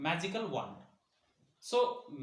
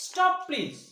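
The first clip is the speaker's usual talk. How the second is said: high-pitched, raised plea; begging; 11 semitones higher, 5 dB louder than usual. A narrator's reading. Boy begging the objects, high loud plea. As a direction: plead loudly for it to stop. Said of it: "means the boy begged".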